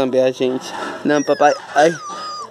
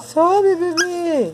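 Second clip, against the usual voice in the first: high coaxing; much slower than usual. Real, not acted. Cheering the baby on to climb. (incentivo com voz fininha)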